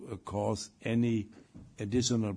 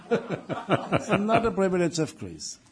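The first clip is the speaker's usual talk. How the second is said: laughing; 7 semitones higher, 6 dB louder, slower than usual. Real, not acted. Repeats line while laughing.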